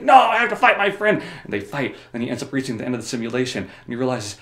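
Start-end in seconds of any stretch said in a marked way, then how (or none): 0.0-1.2 high, loud protest; mock distressed cry. Forced to fight a friend, hammed-up distress. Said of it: "he's like".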